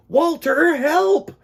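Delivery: panicked penguin yelling help; shouting for help. Acted. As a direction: scream it out like you're caught in the dark. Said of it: "Pete shouted as loud as he could".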